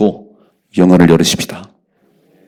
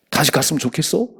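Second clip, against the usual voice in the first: mock question; 7 semitones higher than usual. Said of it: "막 했더니요".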